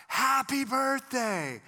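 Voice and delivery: high, loud, drawn-out group cheer; surprise-party shout. Retold or imitated. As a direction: burst out like a whole room shouting surprise. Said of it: "the entire place busts out".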